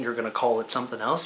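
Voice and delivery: ordinary talk, nothing marked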